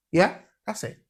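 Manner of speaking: ordinary delivery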